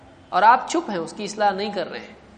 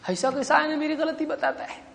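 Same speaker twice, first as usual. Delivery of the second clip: high-pitched, softer put-on complaint; mock indignation; 7 semitones higher, 5 dB quieter than usual. Man objects to a mirror showing his fault, mock indignant. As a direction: act affronted in a raised, small voice.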